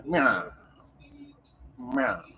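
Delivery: a hint of an old movie gangster meowing; gangster cat. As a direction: meow like a tough guy, see. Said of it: "She talked like an old gangster".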